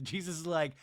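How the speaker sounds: normal talking voice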